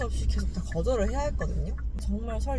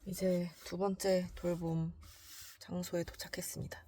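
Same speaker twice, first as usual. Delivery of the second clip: whispering; 4 semitones lower, 8 dB quieter than usual. Real, not acted. Quiet narration at arrival.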